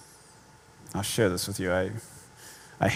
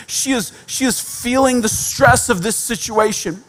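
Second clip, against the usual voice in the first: ordinary talk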